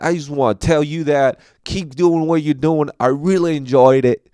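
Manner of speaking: bad Southern accent. Southern fan encouraging the comedian, in a bad Southern accent. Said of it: "I do a really bad".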